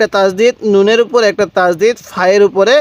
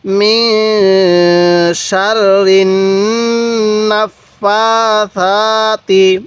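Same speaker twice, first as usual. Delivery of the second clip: stretched, drawn-out Quranic reading; slow recitation; much slower than usual. Shown as a demonstration. Teacher slowly reciting for students to repeat. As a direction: draw out each syllable slowly. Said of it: "কিভাবে পড়ব?".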